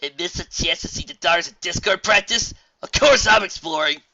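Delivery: excited. Acted. Raindrops eager to watch her idols practise, excited. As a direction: blurt it with sarcastic-proof enthusiasm.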